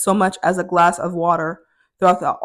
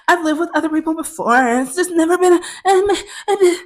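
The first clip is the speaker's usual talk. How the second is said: high, whiny imitation of roommate; mock a child stammering excuses; petulant high voice, faltering at the end; sounding childish; 8 semitones higher than usual. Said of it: "sounding like a child".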